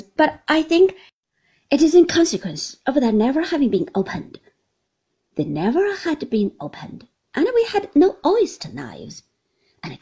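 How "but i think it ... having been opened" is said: faster, louder cheerful verdict; cheerful Traddles. A narrator's reading. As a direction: hurry through the explanation cheerfully. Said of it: "cheerfully examining the dish".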